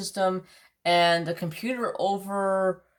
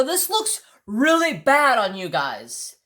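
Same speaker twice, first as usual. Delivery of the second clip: louder, higher, drawn-out scolding; retold pissed-off complaint; 5 semitones higher, 5 dB louder, slower than usual. Customer scolding the store worker, pissed. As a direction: stretch and punch the complaint. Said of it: "I was pissed".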